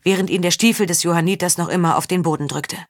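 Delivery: usual speaking voice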